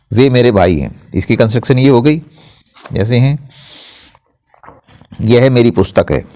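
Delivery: ordinary talk, nothing marked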